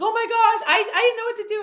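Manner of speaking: high, loud flustered gushing with a laugh. Retold or imitated. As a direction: gush like she's caught off guard. Woman flustered by apology.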